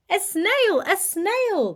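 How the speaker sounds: shocked shriek. Acted. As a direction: shriek in alarm. Teacher shocked.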